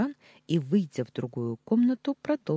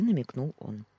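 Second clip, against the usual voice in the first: usual speaking voice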